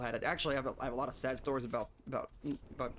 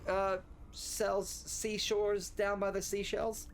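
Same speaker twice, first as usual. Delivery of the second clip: slowly, slipping on seashores and seashells; slow garbled tongue twister; 6 semitones higher, much slower than usual. Shown as a demonstration. Streamer slowly fumbles the tongue twister for chat. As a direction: carefully trip over the S and SH sounds. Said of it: "I can't say Sally".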